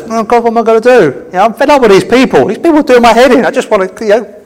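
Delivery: high, exasperated complaint to God; fed-up Moses. Acted. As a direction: raise the pitch and whine in mock despair. Acts Moses fed up, pleading to God.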